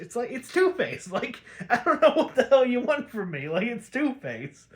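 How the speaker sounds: high-pitched, incredulous protest; comic exasperation. Real, not acted. Host telling listeners the secret villain is obvious, comic exasperation. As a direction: blurt out the obvious, voice jumping up.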